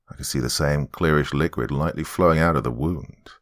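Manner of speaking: usual speaking voice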